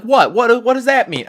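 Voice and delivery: loud, high puzzled dad; confused dad. Retold or imitated. Dad confused by the waving, loud and high. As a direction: bark a puzzled question. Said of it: "he's just like".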